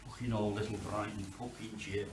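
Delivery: ordinary talk, nothing marked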